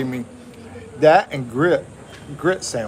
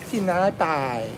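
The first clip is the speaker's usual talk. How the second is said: lightly voiced cute little girl saying it; a touch of a small kid's sweet voice, slower; 3 semitones higher, 3 dB quieter, slower than usual. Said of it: "sound cute if a little, little kid said it".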